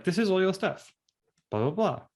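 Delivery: lightly voiced shop owner; breezy hand-off to a client.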